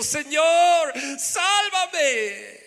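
high, loud drawn-out plea for rescue; crying out. Acted. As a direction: cry out desperately, stretching the words. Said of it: "dio voces diciendo".

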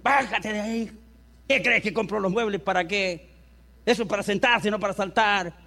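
a fired father snapping at his son on the sofa; mock scolding parent. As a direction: tell the kid off in a bad mood. Irritated parent scolds child.

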